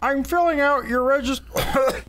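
mock Penny voice. Reading meme in put-on voice.

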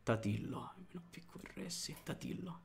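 breathy whisper, barely voiced. Real, not acted. Old lady sleeping downstairs.

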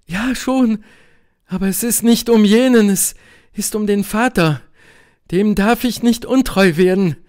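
high woman's voice, urgent objection; insistent Helene. A narrator's reading. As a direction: object earnestly, pitched well up. (eindringliche Helene, hohe Stimme)

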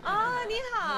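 bright high laugh while saying hello; laughing greeting. Real, not acted. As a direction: greet her through a laugh. Meeting host's wife.

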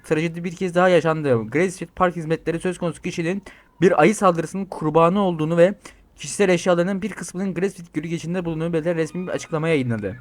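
news-anchor reading. Acted. Player reads an in-game news report aloud. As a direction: read it like a bulletin.

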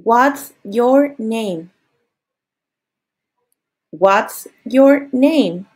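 very slow, word by word; slow model phrase. Shown as a demonstration. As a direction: spell it out slowly for learners. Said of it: "pregúntale a la niña".

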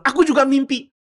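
high, loud, eager baker; eager interjection. Acted. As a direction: burst in with excitement. Baker voiced, eagerly cutting in.

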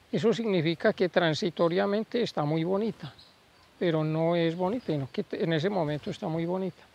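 ordinary talk, nothing marked

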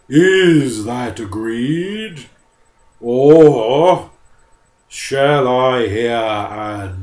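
slow, loud booming bear threat; menacing bear. Acted. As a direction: lean on it with heavy menace. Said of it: "the bear said".

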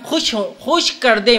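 preacher dwelling on खुश; slow stress on खुश. Shown as a demonstration. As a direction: drag it out and hit खुश each time. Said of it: "देख रहे ना आप".

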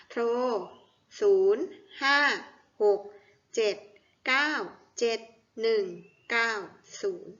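steady counting-out pace; read-along digits. Shown as a demonstration. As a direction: separate digits with short, even pauses. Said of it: "มาอ่านพร้อมๆกันอีกทีค่ะ".

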